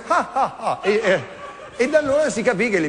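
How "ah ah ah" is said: God laughing. Retold or imitated. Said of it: "Dio stesso guardando disse".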